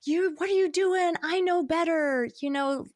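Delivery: high, loud scolding; mock-indignant snap at a spouse; sharp answer. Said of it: "like, a sharp answer".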